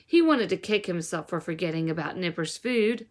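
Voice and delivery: plain reading voice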